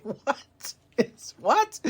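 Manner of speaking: high, incredulous what; can't believe what he just heard; stunned disbelief. Real, not acted. Podcaster reacting to the wrestler's line in disbelief. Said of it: "I was like".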